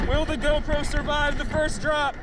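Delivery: excited. Real, not acted. Hosting onboard ride intro.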